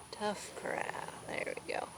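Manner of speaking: unvoiced whisper. Real, not acted. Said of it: "whispering to sleeping kids".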